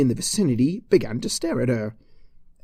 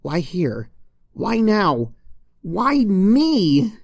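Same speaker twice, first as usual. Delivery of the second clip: from plain to a higher, louder wail; rising exasperation; 8 semitones higher, 5 dB louder, much slower than usual. Acted. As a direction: build each why into a whine. Exasperated complaint building.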